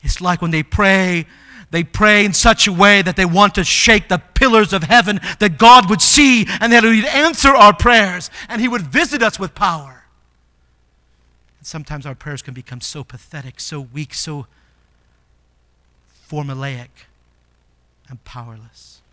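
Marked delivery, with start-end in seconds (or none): 1.7-10.0 loud, building fervour; powerful preaching. Real, not acted. Acts out powerful, heaven-shaking prayer. 11.6-19.0 low, soft, deflated delivery; weak and powerless. Real, not acted. Illustrates weak, powerless prayers.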